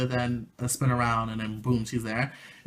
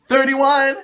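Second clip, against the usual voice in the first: much higher, louder cry of victory; triumphant shout; 12 semitones higher, 14 dB louder than usual. Real, not acted. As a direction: shout the final count like winning a prize. Counting fingers, triumphant finish.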